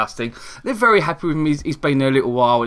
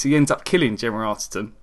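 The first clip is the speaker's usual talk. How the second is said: usual speaking voice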